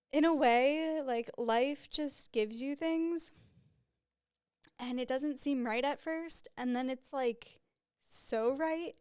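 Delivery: real emotion rising in the voice; choked up. Real, not acted. Podcaster reflecting on what life gives you, choked up. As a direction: overcome with happiness, close to tears. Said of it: "I'm getting choked up thinking about it".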